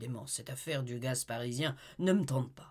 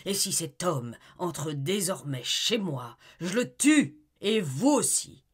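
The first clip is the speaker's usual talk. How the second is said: tyrannical rage; 3 semitones higher, 8 dB louder than usual. Acted. Husband asserting his rights over his wife, in loud threatening rage. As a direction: threaten like a despotic master. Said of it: "Il continua d'exhaler sa rage".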